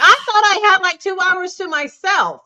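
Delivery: high-pitched hammed-up complaint; mock exasperation. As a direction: act out being robbed of alone time. Voicing a person wanting time alone.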